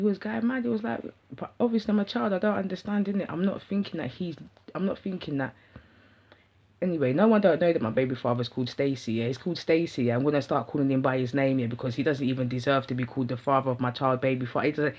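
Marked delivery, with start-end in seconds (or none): none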